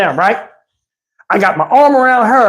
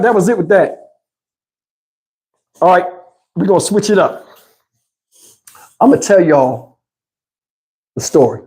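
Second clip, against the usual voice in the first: usual speaking voice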